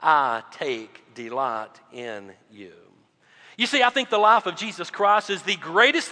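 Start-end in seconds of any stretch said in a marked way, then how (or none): 0.0-2.9 very slow, solemn pronouncement; voice of God the Father. Acted. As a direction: speak as God, each word drawn out slowly. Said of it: "listen to what God the Father says".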